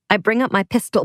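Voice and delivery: usual speaking voice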